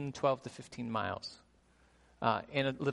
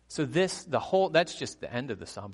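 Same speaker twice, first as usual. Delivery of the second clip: ordinary talk, nothing marked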